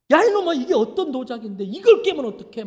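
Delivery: angry father. Retold or imitated. Father scolding his son over the broken vase, angry and loud. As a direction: yell at the boy who broke the vase.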